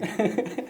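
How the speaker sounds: short breathy chuckle; quick, soft laugh at the memory; amused laugh. Real, not acted. Laughs at the girlfriends revelation.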